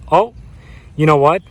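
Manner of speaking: ordinary delivery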